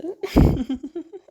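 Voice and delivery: sudden high, loud unhinged cackle. Acted. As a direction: burst into a manic laugh. After saying "bullshit", cackles.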